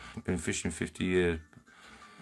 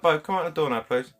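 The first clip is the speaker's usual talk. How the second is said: pleading dad; 8 semitones higher, 6 dB louder than usual. Retold or imitated. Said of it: "And I was like".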